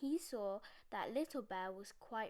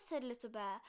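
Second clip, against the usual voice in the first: usual speaking voice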